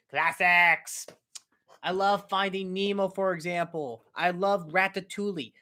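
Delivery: normal talking voice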